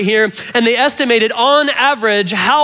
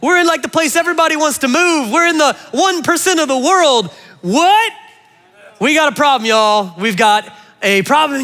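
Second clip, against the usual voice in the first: higher, worked-up outburst; exasperated; 6 semitones higher than usual. Retold or imitated. Pastor voicing his own outraged reaction to the poll, higher. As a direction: preach it like you can't believe it. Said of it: "and I'm like".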